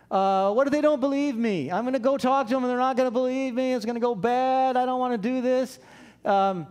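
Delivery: high, hammed-up anxious whine; worried protest. Moses begs the Lord out of the task, acted high whining.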